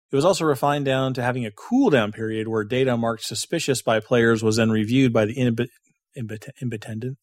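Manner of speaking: normal talking voice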